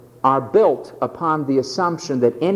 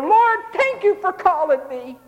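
grateful disciple; 12 semitones higher than usual. Retold or imitated.

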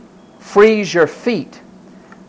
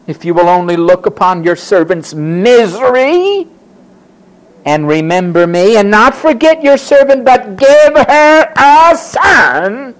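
grand petition; 3 semitones higher, 5 dB louder than usual. Acted. Hannah bargaining with God for a son, grand petition. Acted vow-making prayer to God.

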